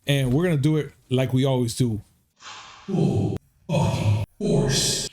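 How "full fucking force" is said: slow, breathy hype whisper; dramatic whisper. Acted. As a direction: whisper the slogan like a trailer. Dramatic slogan of the show.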